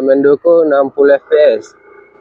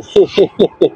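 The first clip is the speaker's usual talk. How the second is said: quick real laugh; short laugh; 5 semitones higher than usual. Real, not acted. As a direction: laugh briefly at own joke. Joking about an earthquake-level shake.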